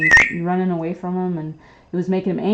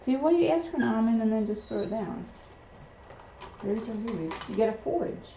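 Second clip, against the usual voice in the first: higher, playful pet-talk to a parrot; talking to pet bird; 5 semitones higher, 5 dB quieter than usual. Real, not acted. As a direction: owner teasing her parrot for dropping food. Scolding pet bird over almonds.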